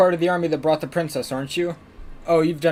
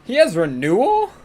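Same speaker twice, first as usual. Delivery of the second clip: surprised; 7 semitones higher, 4 dB louder than usual. Real, not acted. Surprised at enemy skill.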